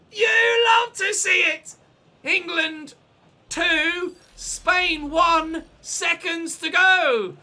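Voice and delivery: loud and high with glee; excited shout. Real, not acted. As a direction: crow it out, still buzzing. Celebrating near full time.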